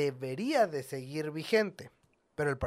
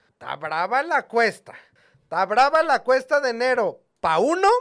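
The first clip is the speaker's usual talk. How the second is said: emphatic; 7 semitones higher, 7 dB louder than usual. Real, not acted. Emphatic host lament.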